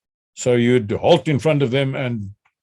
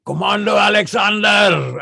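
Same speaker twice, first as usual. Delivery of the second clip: high, acted drill shout; instructor's call; 6 semitones higher than usual. Instructor catching trainee squatted behind a bush, shouted call. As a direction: call the name out like a summons.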